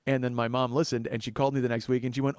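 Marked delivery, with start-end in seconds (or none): none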